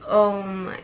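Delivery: ordinary talk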